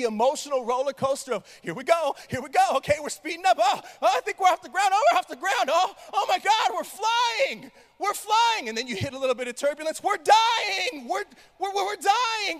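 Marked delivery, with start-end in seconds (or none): 1.6-8.7 rising excitement, higher and louder; thrilled at liftoff, building up; on a zip line. Pretend. First flight takeoff, acted. 10.0-12.6 high panicked shout; mock terror in the turbulence.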